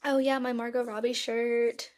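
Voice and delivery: usual speaking voice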